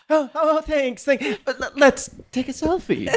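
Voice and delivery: high, tearful-edged brush-off, laughing; oblivious storyteller. Retold or imitated. Mimicking the upset storyteller dismissing a fan, high and laughing. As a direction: wave off the fan while on the brink of tears. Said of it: "and then he's just like".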